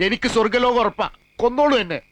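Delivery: high, loud, theatrical begging; melodramatic plea. Acted. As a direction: raise it to a martyr's cry. Pleader dramatically offers to die. (നാടകീയമായ ഉച്ചത്തിലുള്ള അപേക്ഷ)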